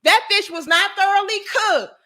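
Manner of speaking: high-pitched indignant complaint; mock outrage, voice pushed up; customer going off. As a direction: imitate an outraged customer insisting. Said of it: "This bitch went off".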